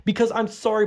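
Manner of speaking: usual speaking voice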